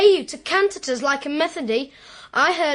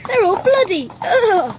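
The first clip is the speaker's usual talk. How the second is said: loud high squeal over the blood; disgusted delight; 4 semitones higher, 5 dB louder than usual. Acted. Boy handles his uncle's bloody birds: loud high squeal. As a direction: relish and recoil at once.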